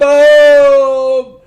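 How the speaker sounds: high loud squeal; shrieked boom. Real, not acted. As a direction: squeal it with delight. Echoing the cheer.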